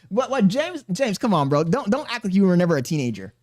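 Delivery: normal talking voice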